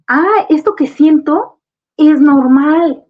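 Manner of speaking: put-on child voice, loud and high with relief; relieved child. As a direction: play the child comforted, brightening up. Child finds grief is normal.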